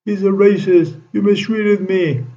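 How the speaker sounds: high mock yelling of a retold complaint; a hammed-up shriek, pitched well up; imitating screaming. Retelling a man screaming at him, in a high mock yell. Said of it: "He was screaming, yelling".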